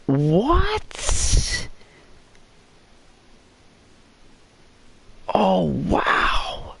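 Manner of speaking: high, amazed cry of wow; real delighted shout, then slowing down; excited disbelief. Real, not acted. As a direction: gush over the new weapon. Reacting to the crossbow find.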